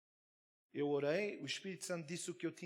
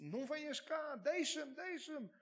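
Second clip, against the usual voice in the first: much higher frantic cry, pushing away; screaming in that voice; 12 semitones higher, slower than usual. Retold or imitated. The possessed man behind a shut door screams at the pastor to keep away. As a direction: shriek to be left alone. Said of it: "Começou a gritar naquela voz".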